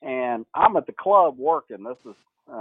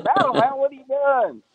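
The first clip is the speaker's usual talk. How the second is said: high, louder mock disbelief; surprised; 13 semitones higher, 4 dB louder than usual. Coach answering a 3 a.m. call, high surprised greeting. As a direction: call out in amazement at the late call. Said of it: "I'm like".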